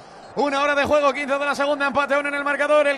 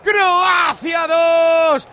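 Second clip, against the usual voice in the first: long, shouted stadium goal call; goal celebration; 4 semitones higher, 4 dB louder, slower than usual. Real, not acted. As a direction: scream the goal to the whole country.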